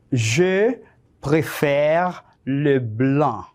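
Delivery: slow, word-by-word French dictation. Shown as a demonstration. Teacher dictates short sentences to test comprehension, slow dictation. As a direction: dictate it slowly with clear gaps for writing. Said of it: "te voy a dictar cinco oraciones cortas".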